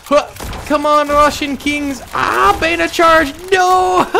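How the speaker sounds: high, loud yelling urging the troops on; frantic cheering. Real, not acted. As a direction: scream at the screen as the charge falls. Cheering charge, then it fails.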